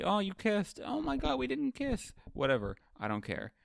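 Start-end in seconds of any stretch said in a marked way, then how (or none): 0.0-2.1 high squealing mock quote. Characters teasing about a kiss, squealed high. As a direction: squeal it like giddy teens. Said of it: "they say".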